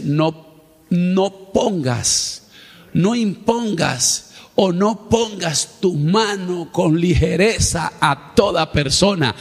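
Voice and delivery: drawn-out, stressed divine warning; each word stretched out like a commandment; slow preaching emphasis; slow solemn command. Acted. Preacher quoting God's command.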